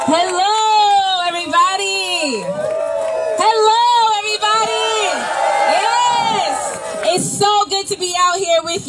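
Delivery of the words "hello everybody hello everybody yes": rally shout. Real, not acted. Rally greeting to crowd.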